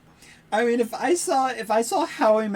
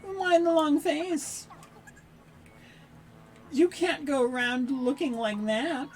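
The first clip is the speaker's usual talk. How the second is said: cheery granny; 9 semitones higher than usual. Acted. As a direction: coax a smile like a sunny granny. Reads the cheery granny's lines.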